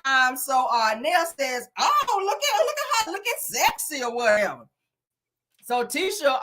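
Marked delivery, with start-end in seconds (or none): 1.8-4.7 laughing compliment. Retold or imitated. Quoting Nell admiring Mel.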